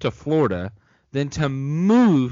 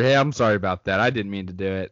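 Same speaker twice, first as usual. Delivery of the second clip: usual speaking voice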